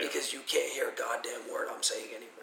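raspy, worn-out voice; hoarse lost voice. Shown as a demonstration. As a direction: croak it like your voice is gone. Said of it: "I'm gonna sound like this".

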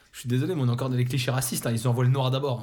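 ordinary talk

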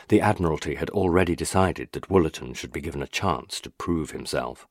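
ordinary delivery

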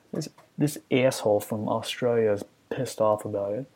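ordinary talk

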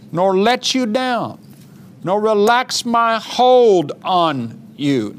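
slow, insistent scripture declaration with long pauses; emphatic vows, phrase by phrase. Acted. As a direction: savor each promise, stretching the key words. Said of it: "read that again just 'cause it feels so good".

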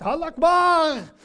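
a sudden, very loud high cry, slowed; retold shout of Allah Akbar. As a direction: yell it out at full force. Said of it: "Zij riep heel hard".

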